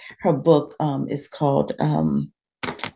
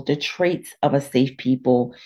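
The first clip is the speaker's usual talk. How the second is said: ordinary talk, nothing marked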